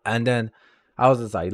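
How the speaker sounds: ordinary delivery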